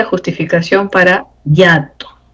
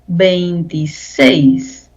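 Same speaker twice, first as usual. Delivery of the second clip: "veintiséis" slowly, stressed on SÉIS; stress on last syllable; much slower than usual. Shown as a demonstration. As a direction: draw out "veintiséis", weight on SÉIS. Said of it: "seis es la sílaba con mayor fuerza".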